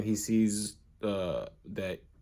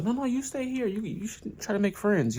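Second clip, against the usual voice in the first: lightly voiced mom; 11 semitones higher than usual. Mom tells Ippo to stay.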